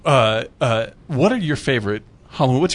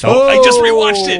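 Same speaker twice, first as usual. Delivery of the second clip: loud, high burst of real excitement; excited recognition; 11 semitones higher, 9 dB louder than usual. Real, not acted. Delighted at the film suggested.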